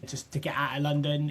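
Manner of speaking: ordinary talk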